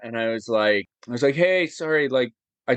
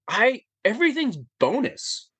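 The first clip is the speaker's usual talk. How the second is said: elated; 9 semitones higher than usual. Retold or imitated. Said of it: "I was just like".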